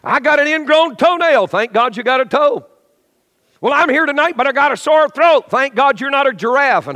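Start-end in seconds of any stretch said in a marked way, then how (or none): 0.0-1.5 higher, louder whining complainer; whiny complaint. Retold or imitated. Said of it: "You say". 3.6-5.5 higher, louder whining complainer; whiny complaint. Retold or imitated. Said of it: "You say".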